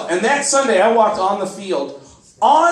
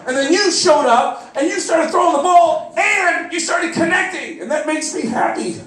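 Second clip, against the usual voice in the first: high-pitched, emotional fan rant; worked-up, heartfelt gushing; gushing outpouring; 7 semitones higher than usual. Acted. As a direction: pour it out to your hero, voice pitched up. Fan's emotional outpouring to quarterback.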